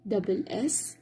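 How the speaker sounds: ordinary delivery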